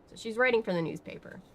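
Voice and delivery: ordinary talk, nothing marked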